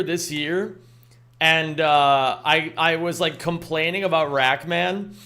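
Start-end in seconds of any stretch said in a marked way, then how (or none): none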